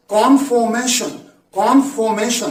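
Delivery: Conformation said clearly with the form kept; careful teacher's conformation; correct form. Shown as a demonstration. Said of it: "formation नहीं बोल सकते".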